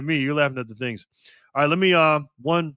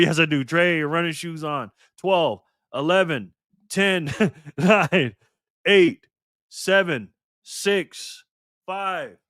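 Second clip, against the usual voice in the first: ordinary delivery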